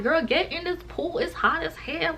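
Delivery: high-pitched imitation of friends; high, insistent coaxing; mock urging. Friends urging her into pool.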